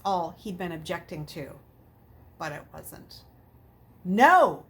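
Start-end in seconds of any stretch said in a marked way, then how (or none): 4.0-4.6 loud, firm no. A narrator's reading. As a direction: shut the question down hard. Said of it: "Luke said firmly".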